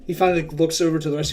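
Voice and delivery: ordinary delivery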